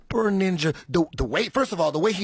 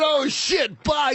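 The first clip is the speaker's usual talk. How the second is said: mocking shout; 10 semitones higher, slower than usual. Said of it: "And I said".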